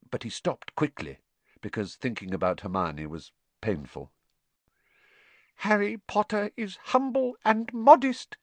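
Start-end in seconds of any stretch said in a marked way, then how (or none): 5.6-8.4 high, squeaky elf in awe; reverent house-elf. A narrator's reading. Dobby admiring modest Harry, high reverent squeak. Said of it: "said Dobby reverently".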